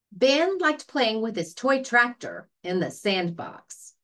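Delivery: ordinary delivery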